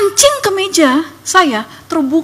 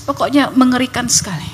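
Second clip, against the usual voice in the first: usual speaking voice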